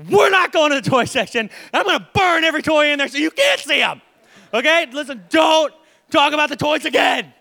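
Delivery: loud, high mock fury; mock yell at a nagging child; dad pretending to threaten burning the toys; hammed-up angry parent. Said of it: "I'm like".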